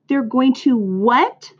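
high, drawn-out shocked question; a narrator's contained scream of disbelief; mild scream. A narrator's reading. Pig learns from old sheep he'll be killed, mild scream. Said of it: "screamed Wilbur".

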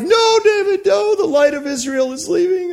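high wailing cry of a grieving crowd. Retold or imitated. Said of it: "people are weeping and wailing".

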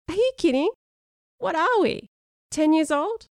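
high-pitched mock-indignant questions; amused disbelief. Friend reacting to Sean's silly biscuit game, amused disbelief. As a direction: protest in high, incredulous bursts.

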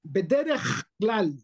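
BEDERECH with a long rasped kh; exaggerated kaf. Shown as a demonstration. Teacher exaggerating so students hear the difference, scraped kh. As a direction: overdo the scrape ending "Bederech". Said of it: "lo estoy exagerando".

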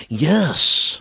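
a hint of a sportscaster's "Yes"; lightly voiced announcer call; Marv Albert yes. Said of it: "You ever hear Marv Albert".

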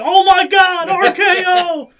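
shouted very high, slow wrestling commentator call; wrestling announcer. Retold or imitated. Imagining a wrestling clip edited over his fall, screaming announcer. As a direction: scream it like a ringside announcer losing it. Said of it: "edited in a fucking Randy Orton".

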